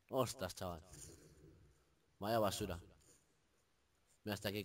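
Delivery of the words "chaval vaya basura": normal talking voice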